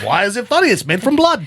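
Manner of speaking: high, loud Irish lilt for the pub guy; mock Irish publican asking; Irish accent. Voicing Irishman Willie.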